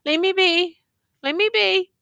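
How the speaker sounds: high, insistent refusal; wounded soldier refuses help from the youth; protesting soldier. A narrator's reading. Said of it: "strangely protested".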